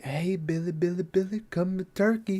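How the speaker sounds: soft, high sing-out to tempt someone; coaxing lure. Retold or imitated. Imitating a cartoon turkey luring a hungry victim, soft coaxing.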